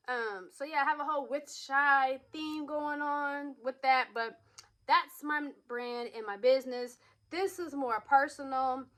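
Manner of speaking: ordinary delivery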